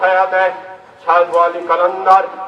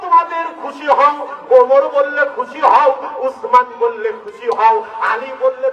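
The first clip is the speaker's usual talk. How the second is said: fiery preaching; 6 semitones higher than usual. Acted. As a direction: call it out over the crowd. Preaching at full force.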